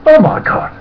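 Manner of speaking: very high, loud horrified exclamation; aghast. Retold or imitated. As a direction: cry out in horror. Ernie sees the news.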